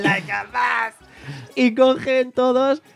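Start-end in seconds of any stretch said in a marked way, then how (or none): 0.0-0.9 cocky tone. Retold or imitated. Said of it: "la corta otra vez y dice".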